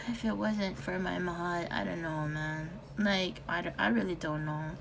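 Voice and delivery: quiet, sinking resignation; sad. Real, not acted. Telling viewers he'd leave without his wife, hushed and low. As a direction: let the words fall away, defeated. Despair after sigh, crushed.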